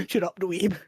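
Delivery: lightly voiced posh gentleman. Quoting Skull as quote of the episode, posh gentleman. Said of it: "his posh gentleman voice".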